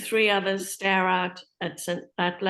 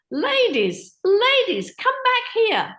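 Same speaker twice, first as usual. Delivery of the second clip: very high, loud shout; frantic calling; 7 dB louder, slower than usual. Acted. Instructor yelling at fleeing women, high frantic shout. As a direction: shout after them in a panic.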